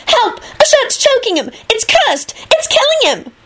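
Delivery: shrieking high-pitched plea; frantic, very high girl's voice; panicked scream. A narrator's reading. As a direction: cry for help in rising panic. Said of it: "she screamed".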